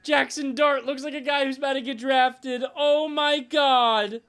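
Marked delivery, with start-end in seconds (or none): 2.8-4.2 slow, louder, higher exclamation; drawn-out oh my God. Real, not acted. Streamer seeing the prospects on screen, slow stunned oh my God. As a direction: stretch it out in shock.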